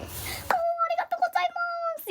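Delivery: very high, long-stretched Japanese thanks; gushing thanks. Retold or imitated. Thanking the stranger who tied the bag, high gushing. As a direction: draw the thanks out in a tiny high voice.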